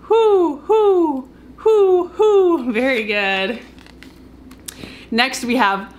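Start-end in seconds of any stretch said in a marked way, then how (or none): 0.1-2.7 higher, louder hoo hoo with a laugh; owl hoots. Shown as a demonstration. Teacher answering what owls say, high laughing hoots. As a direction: hoot like an owl for the kids. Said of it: "And what do owls say?".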